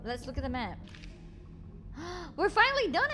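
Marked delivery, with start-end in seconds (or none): 0.8-2.3 quick startled intake of breath; sharp gasp. Real, not acted. Dungeon suddenly finished. 2.4-3.1 high, loud relieved cry; excited. Real, not acted. Dungeon suddenly finished.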